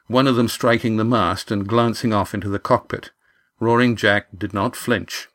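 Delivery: plain reading voice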